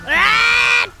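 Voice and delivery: sudden high scream as the birds spot her; startled scream. Real, not acted. Gamer startled by birds, screaming high. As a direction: yelp in real alarm.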